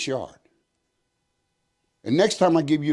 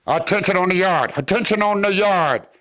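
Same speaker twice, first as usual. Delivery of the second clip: loud, higher call over a megaphone; megaphone announcement; 6 semitones higher, 4 dB louder than usual. Retold or imitated. Gunman announcing to inmates over megaphone, loud call. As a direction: announce it to the whole yard. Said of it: "The gunman gets on the megaphone".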